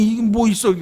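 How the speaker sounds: acted-out startled question, pitched high. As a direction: blurt it out as if caught off guard. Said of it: "깜짝 놀라".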